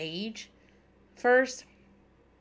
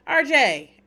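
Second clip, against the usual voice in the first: sharp, much louder, higher call of the name; 7 semitones higher, 10 dB louder than usual. Real, not acted. Host calling out R J over his dog list, loud. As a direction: snap his name to stop him.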